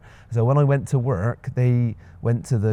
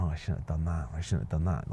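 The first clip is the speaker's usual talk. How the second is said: mild self-reproach; 5 semitones lower, 10 dB quieter than usual. Retold or imitated. Imagined self-critical thoughts while watching.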